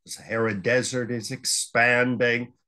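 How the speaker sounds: ordinary talk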